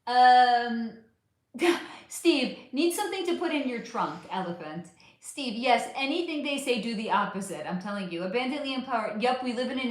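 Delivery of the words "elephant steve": ordinary talk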